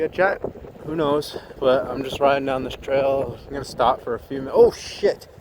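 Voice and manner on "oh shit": sudden high startled cry; startled yelp. Real, not acted. Near collision while riding.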